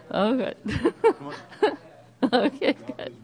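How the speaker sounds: chuckling through the words; laughing. Real, not acted. Relieved after checking everyone is okay, laughing. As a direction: say it while still laughing.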